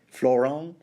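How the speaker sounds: Florent ending on a nasal vowel, no t; French pronunciation. Shown as a demonstration. Host settling on a French name, French pronunciation. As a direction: say Florent as "flor-on", French style. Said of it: "give you the French pronunciation".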